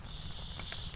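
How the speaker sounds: hushed, voiceless warning shush. Acted. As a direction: shush the child softly to stay hidden. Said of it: "she told me with her finger".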